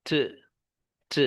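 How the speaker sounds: "ट" as a lone consonant with a puff of air; two separate airy releases of "ट"; aspirated T sound. Shown as a demonstration. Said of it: "टी का sound". (हवा छोड़ते हुए "ट" की आवाज़)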